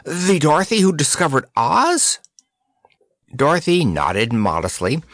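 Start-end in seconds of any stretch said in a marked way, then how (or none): 0.0-2.2 high, rising disbelief; amazed gasp. A narrator's reading. Tatters realizing Dorothy discovered Oz, amazed. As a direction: ask it in astonishment, climbing at the end. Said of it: "gasped Tatters".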